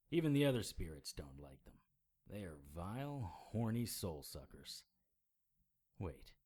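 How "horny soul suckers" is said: usual speaking voice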